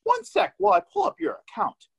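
high, sing-song support-line voice; automated phone voice. Retold or imitated. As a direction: play the overly cheerful help desk. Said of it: "the stupid automated voice".

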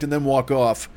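usual speaking voice

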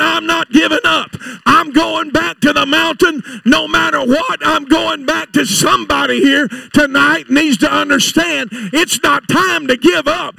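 loud, driving preacher's shout; full-force preaching. Acted. Preacher urging the church not to give up, shouting. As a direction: hammer it home to the congregation.

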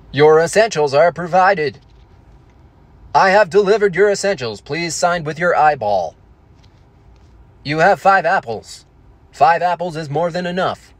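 synthesized delivery-drone announcer, stiff and measured; Stephen Hawking. Acted. Hologram drone delivering to the door, robotic speech-synthesizer impression. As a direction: recite it like a computerized speech machine. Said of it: "Hawkins re-, rings the doorbell".

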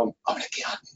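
hushed, voiceless embarrassed confession; whisper. Retold or imitated. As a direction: whisper it behind your hand, as if ashamed. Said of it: "put my hand over and whisper and go".

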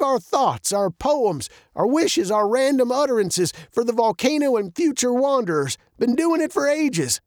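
put-on high-pitched old man storyteller.

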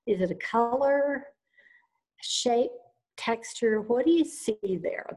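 calm guided visualization voice; guided meditation. Acted.

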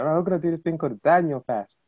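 lightly voiced excited Canadian pastor. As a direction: mimic an eager pastor announcing news. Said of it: "he sounds excited all the time".

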